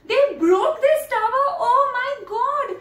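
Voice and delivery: put-on high, aghast cry for children; hammed-up high-pitched dismay; mock horror. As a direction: gasp it out as if appalled. Horrified that crows broke the tower.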